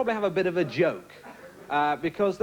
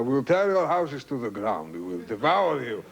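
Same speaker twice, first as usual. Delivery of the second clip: Saddam Hussein; 3 semitones lower than usual. Retold or imitated. Comedian guessing how a dictator speaks, mock-menacing voice. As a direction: declaim a threat in a mock tyrant's voice. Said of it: "I think he speaks like that".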